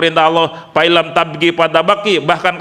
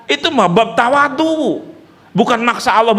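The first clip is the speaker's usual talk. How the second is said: normal talking voice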